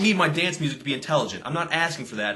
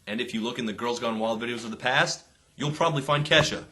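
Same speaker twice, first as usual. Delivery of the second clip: normal talking voice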